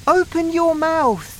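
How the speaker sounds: put-on high, loud boy's order; urgent command. A narrator's reading. Boy urgently orders grandma.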